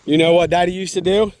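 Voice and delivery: ordinary talk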